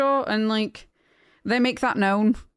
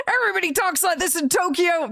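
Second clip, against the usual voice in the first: hammed-up quote of the mustached fighter, higher; mock ad character; 7 semitones higher than usual. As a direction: play the ad guy insisting, a bit higher and jokey. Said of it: "And he's like".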